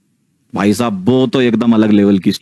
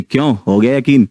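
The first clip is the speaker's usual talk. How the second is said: a hint of a taunting ghost; ghost's voice; 2 semitones higher than usual. Ghost from behind asking if he believes now, lightly voiced taunt. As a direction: lightly voice the ghost mocking him. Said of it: "पीछे से आवाज आई".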